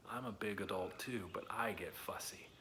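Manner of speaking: soft playful admission, whispered; confessing whisper. Pretend. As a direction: own up in a hushed whisper. Confessing to the kids.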